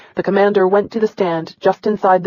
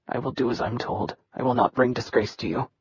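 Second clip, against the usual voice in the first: low, much softer recital; beaten recital; 6 semitones lower, 9 dB quieter than usual. Acted. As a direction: mumble it out, broken. Boy recites while being lashed.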